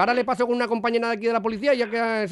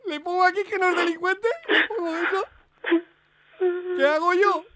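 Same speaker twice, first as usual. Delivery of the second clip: hammed-up sobbing, much higher; fake crying; 7 semitones higher than usual. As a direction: wail in mock despair. Prankster fakes crying back. (llanto fingido exagerado)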